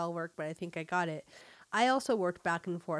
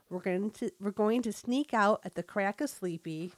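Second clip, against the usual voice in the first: chatty Fargo-style North Dakota mom; North Dakota accent. Acted. Mom chatting about Junior's birthday, North Dakota accent. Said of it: "read with a North Dakota accent".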